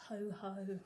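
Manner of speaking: ordinary delivery, nothing marked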